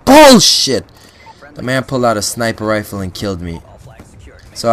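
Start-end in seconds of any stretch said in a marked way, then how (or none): 0.0-0.8 sudden high furious yell; outraged shout. Real, not acted. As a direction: blurt it out in outrage. Gamer killed by a sniper.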